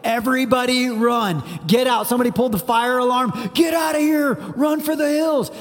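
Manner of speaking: rising to a high, urgent mock yell; preacher voicing a frantic warning to flee; panicked alarm. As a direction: shout it like someone pulled the fire alarm. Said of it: "It's actually like".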